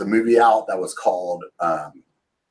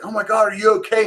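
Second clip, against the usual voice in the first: worried buddy; 12 semitones higher, faster than usual. Retold or imitated. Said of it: "he's like".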